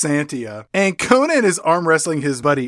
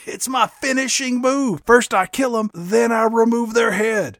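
higher boastful bro; 7 semitones higher than usual. Acted. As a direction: brag about a signature move. Speaks as Bronan.

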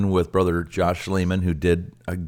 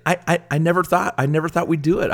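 amazed; 10 semitones higher, faster than usual. Real, not acted. Amazed at reaching the goal.

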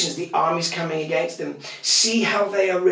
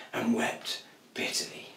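barely breathed, much softer; whispered; 5 semitones lower, 10 dB quieter than usual. A narrator's reading. Storyteller ending Peter's betrayal, whispered sorrowfully. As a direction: let it fall to a whisper.

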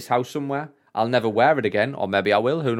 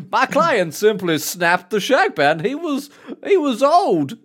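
higher and louder, grand courtroom address; honeyed southern lawyer drawl; 9 semitones higher, 4 dB louder, slower than usual. Retold or imitated. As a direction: play a pompous defence lawyer. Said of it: "the lawyer".

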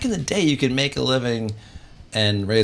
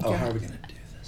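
hushed fast whisper; 10 dB quieter, much faster than usual. Real, not acted. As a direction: whisper it quickly. Whispering while phone dials.

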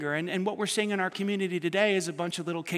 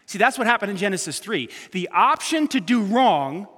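louder, higher pulpit urgency; preaching at full force; 3 semitones higher, 6 dB louder than usual. Real, not acted. Pastor urging church not to yield to evil, fervent and loud. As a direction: exhort them passionately.

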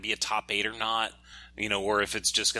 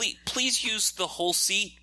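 pleading; 9 semitones higher than usual. Pretend. Mock pleading with players.

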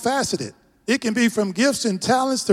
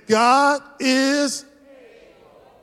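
very slow, higher, held words; drawn-out call; 6 semitones higher, much slower than usual. Real, not acted. Pastor leading the congregation to repeat, drawn out high. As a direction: stretch it out for the crowd to join.

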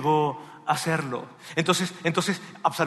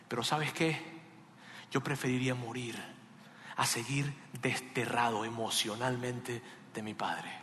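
hushed plea; 5 semitones lower, 9 dB quieter than usual. Acted. Son's plea voiced softly.

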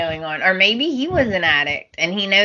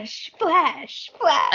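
mock photographer voice; 8 semitones higher, much slower than usual. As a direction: mimic a photographer snapping shots. Mimicking photographer camera flash.

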